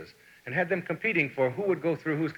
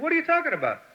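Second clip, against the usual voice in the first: retold clerk; 14 semitones higher, faster than usual. Clerk's baffled reply.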